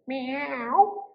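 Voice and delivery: very high, drawn-out meow; little cat. A narrator's reading. Cat answers the little witch, high meowing. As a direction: mew high like a small cat. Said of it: "the little cat said".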